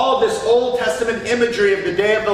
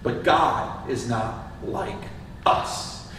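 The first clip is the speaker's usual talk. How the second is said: hushed; 9 semitones lower, 7 dB quieter, slower than usual. Real, not acted. Hushed contrast after pagans' insight.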